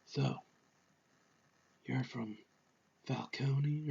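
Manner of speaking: very soft, low, halting murmur; Robert Pattinson's Batman. Acted. Batman questioning someone about Falcone, hushed and halting. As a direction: volume right down, long pauses between phrases. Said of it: "Pattinson's voice is like".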